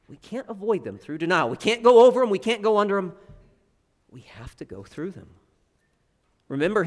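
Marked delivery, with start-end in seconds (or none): 1.5-3.2 "go" punched high and loud; emphatic. Acted. Echoing the rhyme emphatically. 4.1-5.3 quiet. Acted. Rhyme resolution, dropped voice.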